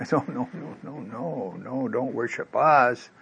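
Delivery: soft, hurried-then-slowing protest; quietly acted modest objection; hushed refusal. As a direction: wave off the praise under your breath. Peter and John refuse worship.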